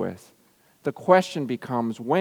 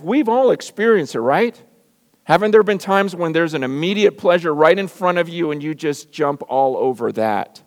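high, loud, driving pulpit delivery; full-force preaching; 3 semitones higher, 6 dB louder than usual. Acted. Pastor preaching to his church, high and loud. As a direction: preach it hard to the congregation.

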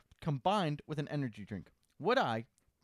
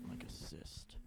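hushed whisper; 10 semitones lower, 15 dB quieter, slower than usual. Real, not acted. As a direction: whisper it as an aside. Whispered aside during advice.

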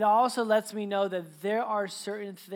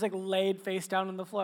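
usual speaking voice

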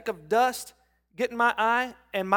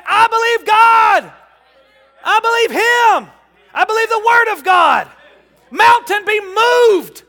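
much higher, loud, slow declaration; believer's shout of faith; 12 semitones higher, 13 dB louder than usual. Acted. Believer standing against the mountain, shouting their faith. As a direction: roar each I believe like a battle cry.

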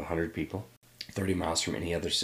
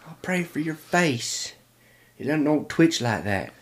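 high, slow, loud southern lady; southern accent; 10 semitones higher, 7 dB louder, slower than usual. Retold or imitated. Concerned neighbor praying for a boy's twitch, in a southern accent. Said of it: "Again, I don't, not from the South".

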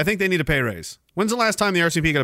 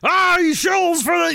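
high, drawn-out mocking outcry; mock accuser; 12 semitones higher, slower than usual. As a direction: whine it out like an outraged heckler. Mimics accusers, then dismisses them.

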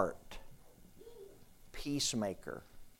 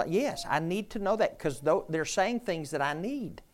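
louder, higher, full-voiced preaching; rousing preaching; 5 semitones higher, 4 dB louder than usual. Real, not acted. Preacher urging the congregation to speak, rising fervour. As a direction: lift the room, build the fervour.

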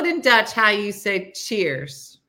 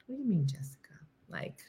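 whisper; 2 semitones lower, 11 dB quieter, faster than usual. Real, not acted. Whispered aside reading comment.

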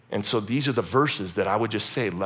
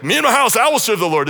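high, loud proclamation; Joshua declaring; 10 semitones higher, 8 dB louder, faster than usual. Retold or imitated. Joshua vowing before the people, loud declaration. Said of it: "Joshua's like".

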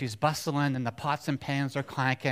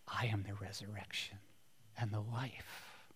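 quiet solemn Jesus; 4 semitones lower, 14 dB quieter than usual. Acted. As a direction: say it hushed, with weight. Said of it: "And Jesus says".

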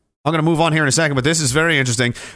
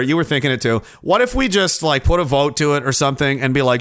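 normal talking voice

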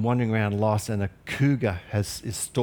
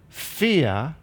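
ordinary talk, nothing marked

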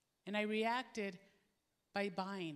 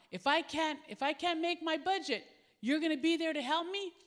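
anxious pleading man; 6 semitones higher, 6 dB louder than usual. Retold or imitated. Anxious man asking pastor.

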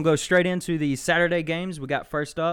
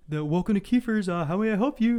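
raised greeting voice; 6 semitones higher than usual. Retold or imitated. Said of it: "he goes".

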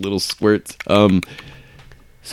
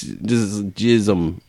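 usual speaking voice

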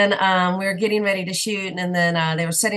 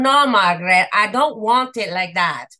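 put-on Dutch accent; 2 semitones higher, 6 dB louder than usual. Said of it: "Jan de Bont's accent was really thick".

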